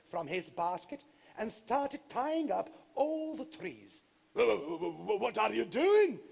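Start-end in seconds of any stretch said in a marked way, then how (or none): 4.3-6.2 stuttering, startled giant; stammering surprise. Acted. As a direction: stumble over the words in shock. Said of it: "exclaimed the giant".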